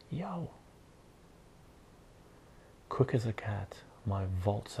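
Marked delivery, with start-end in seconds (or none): 0.1-0.5 high-pitched acted yow; startled yelp. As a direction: yelp as the bull breaks loose. Farmhand startled as bull breaks loose.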